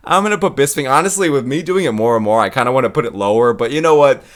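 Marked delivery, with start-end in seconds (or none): none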